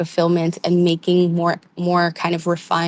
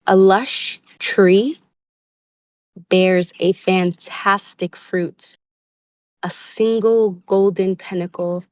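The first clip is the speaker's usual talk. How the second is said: slow, weighted reread with rise on tree; slower emphatic reread; slower than usual. Shown as a demonstration. Reader rereads card line slowly to viewers. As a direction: savor it, lingering on tree. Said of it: "let me read that again".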